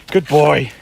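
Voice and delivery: ordinary delivery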